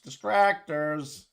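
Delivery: Distractors. stretched very slow, every syllable held; drawn out. Shown as a demonstration. Streamer mocking a word for chat, stretched very slow.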